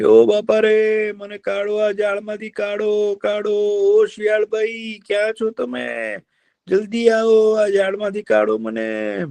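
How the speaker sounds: high, slow, pleading cries of a trapped deer; trapped deer crying for help. Acted. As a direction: call out desperately, stretching each plea. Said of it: "કે". (જાળમાં ફસાયેલા હરણની ઊંચી, ધીમી આજીજી)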